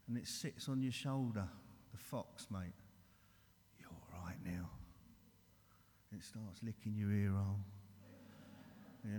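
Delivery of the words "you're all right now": whispered temptation, soft and close; sly whisper. Acted. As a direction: breathe it into his ear like a tempter. Illness whispering in your ear.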